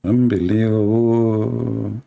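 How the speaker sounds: very slow, stretched mock amazement; drawn-out disbelief. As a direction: drag the word out in disbelief.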